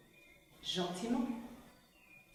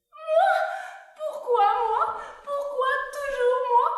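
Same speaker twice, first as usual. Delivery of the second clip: high, loud indignant protest; outraged squeal; 9 dB louder than usual. Pretend. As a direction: shriek it in mock outrage.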